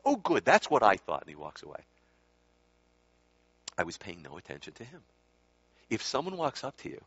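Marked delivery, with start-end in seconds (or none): 0.0-1.2 loud, emphatic satisfaction; pleased approval. Retold or imitated. Swami finally told he needn't take the case, loud and satisfied. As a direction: pounce on the answer you wanted, loudly. Swami gets his wanted answer.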